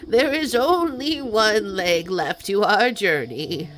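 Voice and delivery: loud, slow goat voice. Acted. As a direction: boom it out as the goat. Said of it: "I'm glad you like the goat voice".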